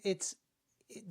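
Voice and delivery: normal talking voice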